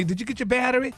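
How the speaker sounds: high, pestering mock question; high-pitched mock questioning; nagging churchgoers. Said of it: "People at church asking me".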